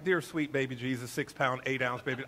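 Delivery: a hint of a syrupy baby-Jesus prayer; mock prayer. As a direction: send up a cutesy prayer. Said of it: "I wasn't praying like".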